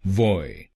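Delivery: वॉय said as one gliding syllable "voy"; wrong merged syllable. Shown as a demonstration. Teacher showing the mistaken merged syllable, wrong pronunciation. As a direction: squash it into a single "voy" for वॉय. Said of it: "गलत pronunciation में हम ... एक शब्दांश की तरह बोलते हैं".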